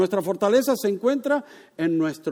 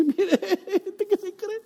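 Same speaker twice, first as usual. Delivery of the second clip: much higher, laughing sneer of the devil; mocking devil; 10 semitones higher, slower than usual. Preacher voices the devil scoffing at believers, laughing mock voice. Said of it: "el diablo se ríe y dice".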